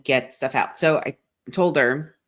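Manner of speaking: usual speaking voice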